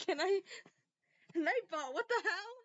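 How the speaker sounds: high-pitched, incredulous complaint; real flustered protest. Real, not acted. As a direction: squeal in exasperated disbelief. Protests at misbehaving chat bot.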